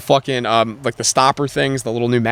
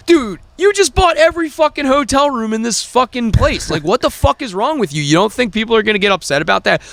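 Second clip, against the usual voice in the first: high-pitched, incredulous tirade; re-enacted angry protest; indignant rant; 10 semitones higher than usual. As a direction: let the outrage push your voice way up. Retold row over booked-up motel.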